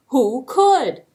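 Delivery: plain reading voice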